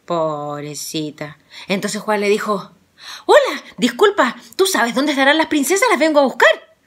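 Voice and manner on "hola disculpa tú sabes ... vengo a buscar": higher, louder eager greeting; polite young hero. Acted. Juan greets the girl, politely eager. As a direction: brave boy asking politely for the princesses.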